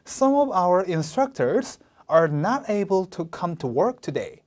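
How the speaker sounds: slow, NOT hit hard; slow read with "not" stressed hard. Shown as a demonstration. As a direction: punch the word "not". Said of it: "부정어, not. 보였다면 세게 읽어야 돼요".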